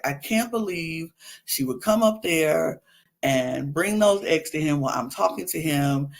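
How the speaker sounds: ordinary delivery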